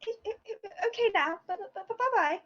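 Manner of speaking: high, stuttering goodbye; a shy girl stammering farewell; nervous stammer. Acted.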